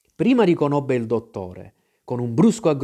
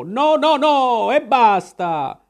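loud, high cry of refusal, stretched at the end; angry cry; 9 semitones higher, 4 dB louder, much slower than usual. A narrator's reading. Captain shouts down his visitor in a quarrel, angry cry. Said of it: "gridò una prima volta".

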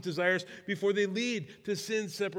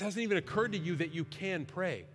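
lower, softer, quicker gentle address; gentle invitation; 4 semitones lower, 5 dB quieter, faster than usual. Acted. Preacher gently addressing newcomers who never prayed, soft and low. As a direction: speak kindly to a newcomer.

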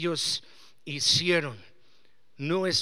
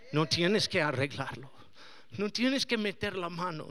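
ordinary delivery

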